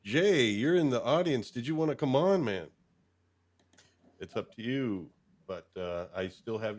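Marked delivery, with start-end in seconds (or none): none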